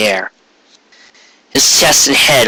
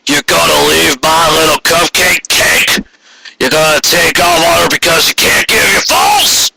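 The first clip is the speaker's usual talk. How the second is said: accusing father-in-law; 4 semitones higher than usual. Acted. Quarry confronts his son-in-law.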